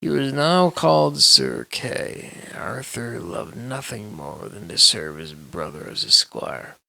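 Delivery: plain reading voice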